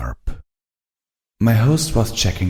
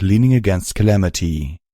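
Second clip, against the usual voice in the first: ordinary delivery, nothing marked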